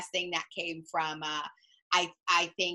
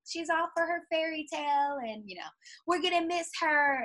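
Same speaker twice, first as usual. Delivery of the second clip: mock fans; 8 semitones higher than usual. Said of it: "everybody's like".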